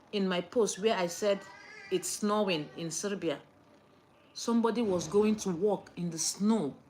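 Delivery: normal talking voice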